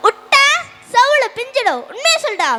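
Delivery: loud, high-pitched scolding burst; angry threat. Acted. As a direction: threaten him into telling the truth. Father threatens son, demands truth.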